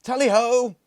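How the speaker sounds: very high, very loud hunting cry; hallooed. A narrator's reading. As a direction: sound the hunt's halloo. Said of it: "he hallooed".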